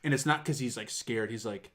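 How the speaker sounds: ordinary talk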